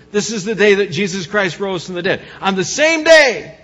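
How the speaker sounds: soaring sermon peak; climactic preaching. Acted. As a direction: build to a triumphant Easter climax.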